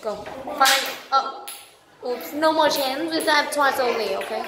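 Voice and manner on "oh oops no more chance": ordinary talk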